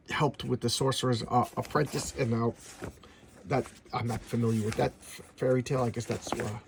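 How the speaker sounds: usual speaking voice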